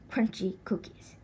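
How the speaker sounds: ordinary delivery, nothing marked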